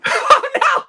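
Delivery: loud shocked cry; surprised shout. Real, not acted. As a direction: gasp it out in alarm. Shocked at taped-up puppet.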